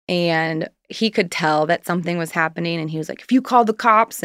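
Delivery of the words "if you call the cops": retold yell. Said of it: "and he's yelling at me".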